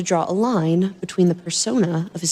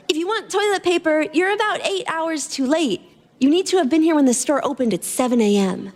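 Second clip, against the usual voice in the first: put-on higher, light young woman's voice; girl's voice; 7 semitones higher than usual. Girl teasing Adrian about the toilet paper, light young voice. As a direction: voice the cheeky girl in the store.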